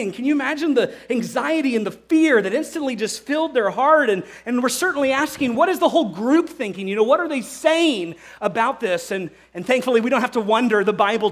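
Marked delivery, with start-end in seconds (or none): none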